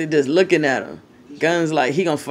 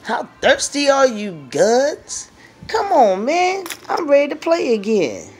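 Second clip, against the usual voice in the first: Bullet's voice; 8 semitones higher than usual. Pretend. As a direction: voice the older dog urging the pup to hurry. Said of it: "He like".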